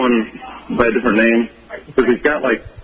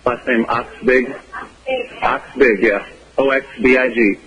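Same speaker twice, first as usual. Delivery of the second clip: very slow; 3 semitones lower than usual. Shown as a demonstration. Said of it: "say the name very slowly".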